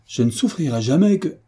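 high, indignant protest, left unfinished; exclaiming. A narrator's reading. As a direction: refuse with feeling. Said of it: "s'écria de Raville".

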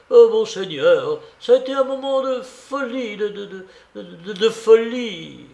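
stupefied stammering. A narrator's reading. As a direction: stutter helplessly on the little word before the last. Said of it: "il continuait de bégayer".

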